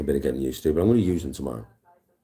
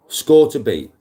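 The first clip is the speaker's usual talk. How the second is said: loud announcement; 9 semitones higher, 9 dB louder, slower than usual. Real, not acted. Golfer hyping his stream viewers, loud announcement. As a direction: call it out high and loud like a challenge.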